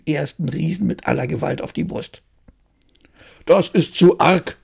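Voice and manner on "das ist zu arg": giant shouts. Acted. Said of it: "schrie er".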